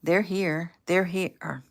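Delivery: ordinary delivery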